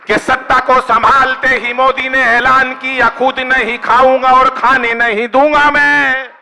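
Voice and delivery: slow, weighty recitation of the promise; drawn out like a solemn vow; slow declaimed line. Acted. Poet to audience, slow solemn declaiming.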